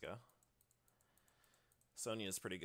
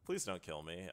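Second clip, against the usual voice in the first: ordinary talk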